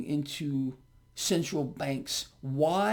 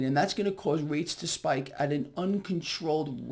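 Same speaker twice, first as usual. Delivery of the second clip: ordinary delivery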